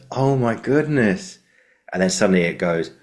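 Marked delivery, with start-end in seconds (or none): 0.1-1.4 high, slow, drawn-out gasp of recognition; stunned recognition. Retold or imitated. Prisoner recognizing his old classmate, high slow stunned. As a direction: let it dawn on you slowly.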